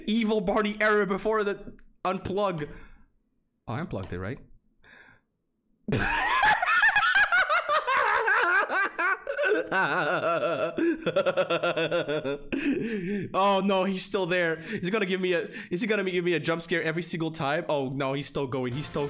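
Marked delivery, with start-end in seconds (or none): 5.9-13.3 loud shrieking laugh-scream; real scared shrieking laughter. Real, not acted. Jump scare reaction.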